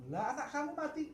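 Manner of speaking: high, disbelieving cry; stunned question. Acted. A mourner asking the saint in disbelief, high and stunned. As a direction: say it as if you can't believe it.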